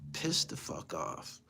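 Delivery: slow, breathy whisper; whispered aside. Real, not acted. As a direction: hiss it under your breath. Whispered aside about lost bill.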